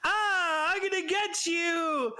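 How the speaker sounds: high playful monster shout; fake monster voice. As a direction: pretend to be a scary monster. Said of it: "And she's like".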